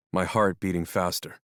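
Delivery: ordinary delivery, nothing marked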